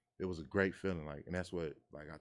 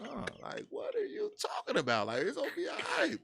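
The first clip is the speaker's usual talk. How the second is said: much higher, louder pleading protest; exasperated son; 6 dB louder than usual. Retold or imitated. Son telling his mom it's all legit, high and exasperated. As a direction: squeak out a disbelieving protest to mom. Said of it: "I'm like".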